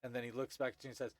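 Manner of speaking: ordinary delivery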